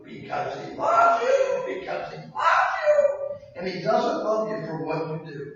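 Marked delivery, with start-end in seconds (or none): 0.0-3.3 much higher, slow, rising to a shout; building to a shout. Acted. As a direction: build it up and cry it out. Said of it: "repeated refrain building".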